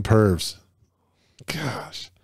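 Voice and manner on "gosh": whispered gosh. Real, not acted. Mutters after reading rude village names.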